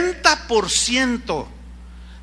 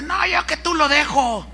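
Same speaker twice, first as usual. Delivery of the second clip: higher, knowing gossip voice; higher meddling gossip; gossiping commenter; 5 semitones higher than usual. Retold or imitated. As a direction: meddle like a nosy church gossip. Gossip replies on Facebook.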